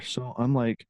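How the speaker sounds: normal talking voice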